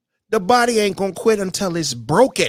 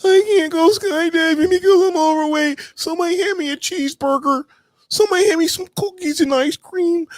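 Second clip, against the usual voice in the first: much higher, hammed-up whining voice; mock whiny complainer; 10 semitones higher than usual. Mocking the lazy complainer begging for cheeseburgers, high and whiny. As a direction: whine like a spoiled complainer. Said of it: "I'm not gonna complain every day".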